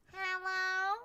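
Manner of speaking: high meow-like hello; cat meow voice. Retold or imitated. Imitating her cat calling out a high hello. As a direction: mew it like the cat. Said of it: "That's what her meow sounds like".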